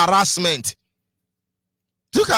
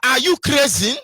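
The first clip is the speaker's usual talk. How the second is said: mock insult; 5 semitones higher, 5 dB louder than usual. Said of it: "somebody tell you".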